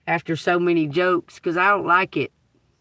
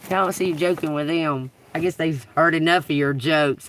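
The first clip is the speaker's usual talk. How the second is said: ordinary talk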